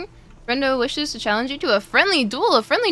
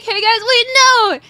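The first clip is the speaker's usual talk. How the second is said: excited protest; 7 semitones higher, 6 dB louder, slower than usual. Real, not acted. Protesting to chat.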